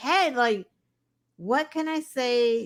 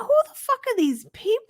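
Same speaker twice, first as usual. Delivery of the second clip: exasperated outburst; 10 semitones higher, faster than usual. Real, not acted.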